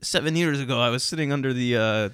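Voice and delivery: light Northeast Philly accent impression. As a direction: do your Northeast Philly guy. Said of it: "That's my Northeast Philly accent".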